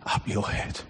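breathy whisper fading to nothing. Retold or imitated. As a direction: breathe the words to one listener. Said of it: "as if it was the Father saying it".

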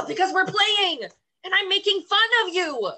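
furious yelling, high and loud; heated family shouting. Acted. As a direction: shout it straight back at him. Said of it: "Can you please stop yelling at me?".

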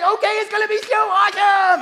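very high, loud mock-teen squeal; excited teenage athlete. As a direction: shout it like a thrilled teenager. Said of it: "this is my teenage, any teenager, mouth full of braces".

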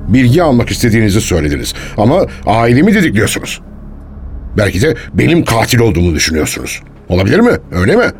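quick, affronted challenge rising in pitch; indignant protest. Acted. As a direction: push back at the accusation, speeding up. Witness challenges police over accusation.

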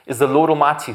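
high, loud proclaimed cry; loud, high ringing praise. Acted. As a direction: cry it out like the heavenly creatures. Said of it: "who night and day cry out".

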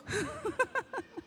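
a sudden high laugh that dies away; high burst of laughter. Real, not acted. Laughs at daughter's line.

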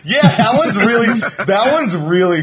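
talking through laughter; laughing. Real, not acted. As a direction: laugh as you say it. Laughing at the interview.

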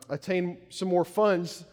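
ordinary talk

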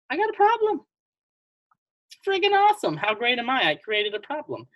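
high, loud gushing over a problem; overjoyed like it's a gift, voice leaping up; mock delight. Showing how to enjoy a problem, high loud mock glee. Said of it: "rather than just being like".

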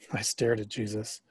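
plain reading voice

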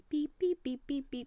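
high beeping imitation; high imitated phone beeps. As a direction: mimic keypad tones.

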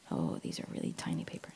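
whispered aside to herself. Real, not acted. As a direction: drop to a whisper over the notes. Aside while sorting notes.

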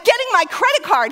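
high, loud, defiant answer; frantic determination. Acted. Wife defending her plan to husband, frantic.

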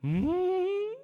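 high, loud, drawn-out dog hum; a dog's hungry mm, hammed up. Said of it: "and the dog goes".

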